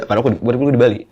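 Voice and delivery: normal talking voice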